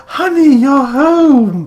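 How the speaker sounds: high, slow, eerie wife greeting; ghostly wife voice. Retold or imitated. Mocking the possessed wife, high and slow. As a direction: croon it like a spooky returning wife.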